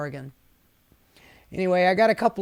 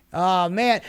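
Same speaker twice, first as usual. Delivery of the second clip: normal talking voice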